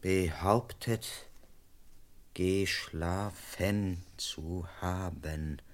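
slow and quiet, writing it down with doubt; slow doubtful dictation. Acted. Constable notes the butler's alibi, slow and doubtful. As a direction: sound unconvinced as you write. Constable noting answers in notebook.

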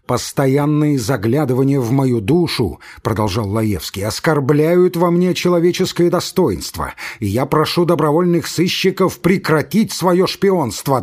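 rising from complaint to a high, loud outburst; building furious shout. Acted. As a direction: explode by the end, slowing on the last words. Laevsky denounces the spies.

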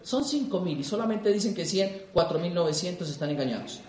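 low, hushed doubting remark; skeptical aside. Retold or imitated. Newcomer doubting the roaring convention crowd, low skeptical aside. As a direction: drop down soft and low, unconvinced. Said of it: "Yo decía".

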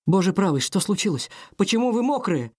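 high, worried questions; alarmed. Acted. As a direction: burst out in alarm. Maid alarmed at soaked prince.

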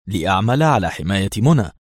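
ordinary delivery

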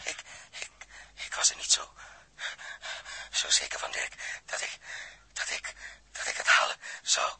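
broken unvoiced whisper, near tears; fighting for each word with no air; gasping whisper. Short of air, confessing to Dirk, a broken whisper. Suffocating man, near collapse.

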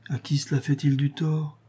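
plain reading voice

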